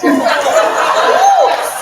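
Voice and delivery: a loud, high real laugh bursting out; big high laugh; burst of laughter. Real, not acted. Laughs at lip balm story.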